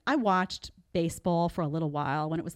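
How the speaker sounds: ordinary delivery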